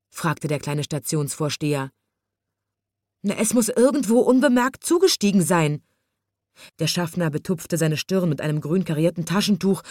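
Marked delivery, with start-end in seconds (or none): 3.2-5.8 flustered. A narrator's reading. Conductor guesses, flustered.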